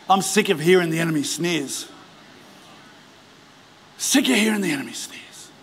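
usual speaking voice

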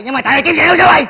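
fast, high, loud outburst; angry accusation. Acted. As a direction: snap back loudly and fast. Accuses friend in renewed quarrel. (gắt lên đổ lỗi)

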